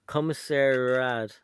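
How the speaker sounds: normal talking voice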